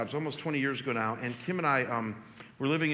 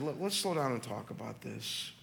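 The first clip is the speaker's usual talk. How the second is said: gentle, calming; 3 semitones lower, 6 dB quieter than usual. Retold or imitated. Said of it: "I looked at Kim, I said".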